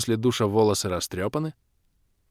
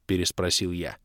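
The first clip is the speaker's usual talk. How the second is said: ordinary delivery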